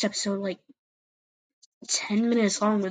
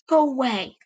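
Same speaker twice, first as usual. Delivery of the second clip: hissed; 5 semitones higher, 6 dB louder than usual. A narrator's reading. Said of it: "crouched down and hissed".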